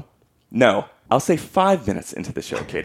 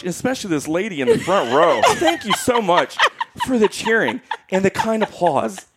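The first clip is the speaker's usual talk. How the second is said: higher, laughing through the thanks; laughing quote; 9 semitones higher than usual. Retold or imitated. Sister quoting the performers' thanks, laughing.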